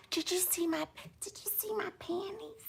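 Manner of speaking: high, breathy whispered tease; mock flirty whisper. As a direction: drop to a naughty whisper. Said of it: "ask him".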